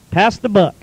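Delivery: ordinary talk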